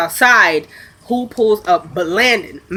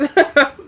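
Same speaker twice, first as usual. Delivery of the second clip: short laugh; 6 semitones higher than usual. Real, not acted. Laughs at the interview answer.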